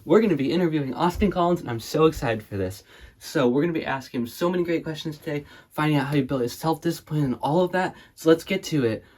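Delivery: loud, high-pitched hype host; over-excited interviewer. Acted. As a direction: gush like a wildly enthusiastic interviewer. Plays hyped-up interviewer.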